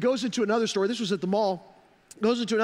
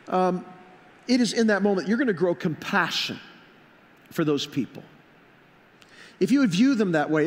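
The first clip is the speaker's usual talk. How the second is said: louder, driving sermon voice; preaching at full force; 3 dB louder than usual. Acted. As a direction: press the point home to the congregation.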